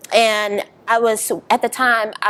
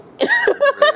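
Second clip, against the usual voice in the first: 1 high burst of laughter. Real, not acted. Laughs at the end of her story.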